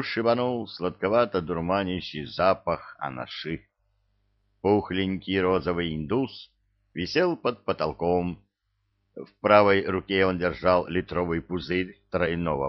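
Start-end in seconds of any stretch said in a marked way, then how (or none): none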